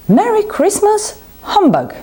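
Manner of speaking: high sneering echo of merry christmas, then humbug; a miserly old uncle scoffing at his cheerful nephew; mocking scoff. A narrator's reading. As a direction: throw the greeting back with scorn. Scrooge scoffs at nephew's greeting.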